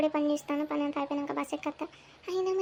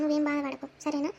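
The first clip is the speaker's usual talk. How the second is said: ordinary talk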